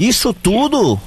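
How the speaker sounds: high, drawn-out astonished question; mock-shocked surprise. Real, not acted. Host reacting to caller's five relationships, surprised. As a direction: stretch it out high in disbelief.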